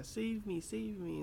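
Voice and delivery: panicked plea. Retold or imitated. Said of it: "saying, like".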